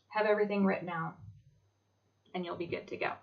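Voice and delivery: ordinary talk, nothing marked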